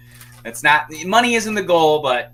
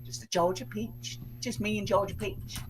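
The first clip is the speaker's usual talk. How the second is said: soft, higher Southern lilt; Southern accent; 7 semitones higher, 10 dB quieter than usual. Pretend. Host toying with the viewer's name, soft Southern accent. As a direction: play with a quiet Southern accent. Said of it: "it just comes out".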